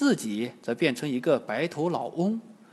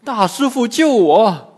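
trembling plea; 8 semitones higher, 12 dB louder than usual. A narrator's reading. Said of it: "颤抖着说".

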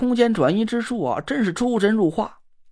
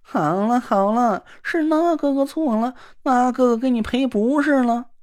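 simpering sissy man; 5 semitones higher than usual. Acted. Na Ruolan fawning after the girl who ignores him, simpering. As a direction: coo at her like a fawning dandy. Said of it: "纳若兰赶紧陪笑说".

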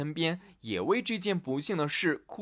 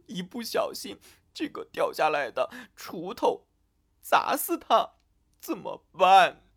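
tearful wailing; 6 semitones higher than usual. A narrator's reading. Elsa imagines her future child killed, crying. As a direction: sob through the worry, wail at the end. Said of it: "突然哭了起来".

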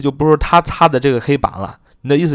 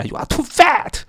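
mock rude remark; slower than usual. Shown as a demonstration. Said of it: "这有点不礼貌".

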